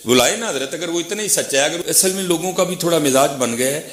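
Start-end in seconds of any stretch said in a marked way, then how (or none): none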